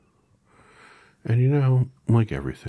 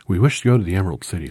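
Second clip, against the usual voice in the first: put-on fast, loud eager girl; Dorothy voice; 5 semitones higher, 10 dB louder, much faster than usual. A narrator's reading. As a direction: rush the request out eagerly. Dorothy asks urgently.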